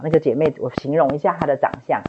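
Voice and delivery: normal talking voice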